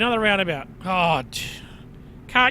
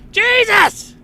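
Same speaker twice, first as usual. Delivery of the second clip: high, loud road-rage yell; explosive cry at a bad driver; exasperated shout; 12 semitones higher, 8 dB louder than usual. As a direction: shout it in sudden outrage. Angry outburst at other drivers.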